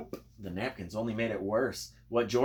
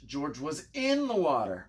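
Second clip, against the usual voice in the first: ordinary delivery, nothing marked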